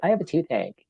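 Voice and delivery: whining. Retold or imitated. Student interrupts her lesson about a toothache, mock whining. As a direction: imitate a complaining student. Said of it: "she starts whining about toothache".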